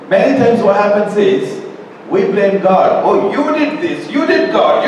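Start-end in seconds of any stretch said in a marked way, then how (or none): 3.0-4.1 whiny accusing voice, pitched higher; mock complaint. Preacher mimics people blaming God, high whiny complaint. 4.1-4.9 high whiny accusation, you did God; mock complaint. Preacher mimics people blaming God, high whiny.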